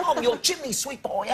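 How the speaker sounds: high, loud hammed-up Cockney through laughter; bad fake Cockney accent. Host mocks Americans faking British, high laughing Cockney. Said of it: "How I would do it".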